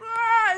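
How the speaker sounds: high, loud fake cry; imitated child's startled bawl; mock toddler wail. Acting a toddler wailing in response.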